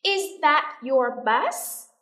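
very slow question, rising at the end; drawn-out, careful question with a final lift; repeat-after-me model. Shown as a demonstration. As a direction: spell out each word for learners. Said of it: "listen and repeat after me".

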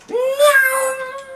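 high squeaky "neeow"; imitated car zoom. Playing with new toy cars, squeaky engine noise. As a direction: whizz like a toy race car.

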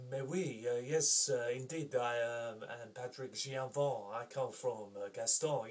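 lightly put-on French accent. Shown as a demonstration. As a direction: play a Frenchman introducing himself, French accent. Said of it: "um, French accent".

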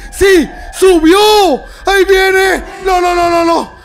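excited shouting. Real, not acted. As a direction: cry out in thrilled alarm. It climbed and now comes at them.

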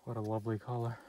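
breathless after the stairs; panting between words; out of breath. Real, not acted. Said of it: "out of breath".

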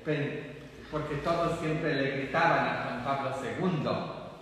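ordinary talk

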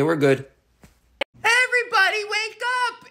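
normal talking voice

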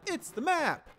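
shrill excited map cry; high squeal. Acted. Streamer hamming up the map line, high squeal. Repeating game line in voices.

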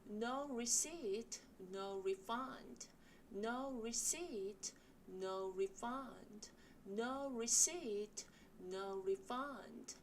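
slow, spaced reading of the shop sign. Shown as a demonstration. Teacher reading a shop sign aloud for students. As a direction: read the sign slowly, "No" rising, "refund" dropping. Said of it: "같이 한번 연습해 보실게요".

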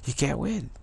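ordinary delivery

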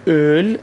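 اول with rounded lips and a drawn-out ö; rounded o-umlaut. Shown as a demonstration. Teacher shows Iranian learners a hard vowel, اول with long rounded ö.

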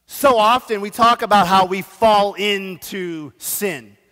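normal talking voice

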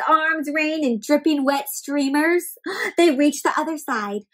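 usual speaking voice

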